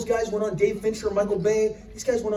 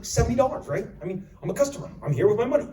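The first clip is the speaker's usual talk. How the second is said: ordinary delivery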